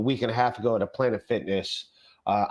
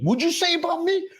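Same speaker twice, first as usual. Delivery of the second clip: much higher mock outraged squeal; offended guy; 3 dB louder than usual. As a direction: squeak it out like a touchy fat bald guy. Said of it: "He's like".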